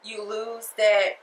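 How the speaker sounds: usual speaking voice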